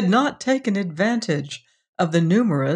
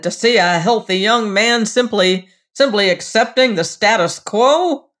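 put-on indignant old man; 5 semitones higher, 6 dB louder than usual. Old Osmond argues with young man.

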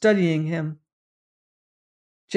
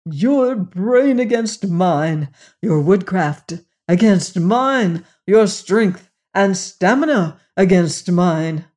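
slow, emphatic build with relish; louder, weighing out each contest; enthusiastic; 5 dB louder, slower than usual. A narrator's reading. The general pitching outdoor chess to his opponent, enthusiastic. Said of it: "the general said enthusiastically".

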